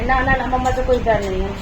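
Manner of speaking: mother sulks at son, playful mock hurt; pretend sulking. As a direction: act offended to tease the child. Pretend hurt, refusing son's offer.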